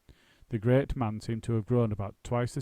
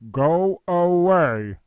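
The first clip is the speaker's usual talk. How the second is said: slow, loud, booming actor's voice; 8 semitones higher, 13 dB louder, much slower than usual. A narrator's reading. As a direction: boom it out from the couch. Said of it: "said a booming voice".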